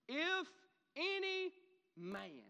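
high, word-by-word proclamation falling at the end; crying out. Acted. Preacher quoting the verse before stopping on it, deliberate cry. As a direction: proclaim each word separately, pausing between. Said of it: "Jesus stood and cried, saying".

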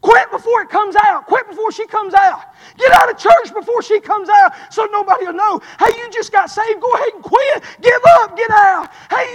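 high, hammering acted taunts; devil's goading. Devil telling a believer to quit church, high goading. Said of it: "You know what old devil's doing".

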